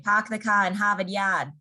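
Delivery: Boston accent with the R's dropped. Shown as a demonstration. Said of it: "they kind of skip their R's".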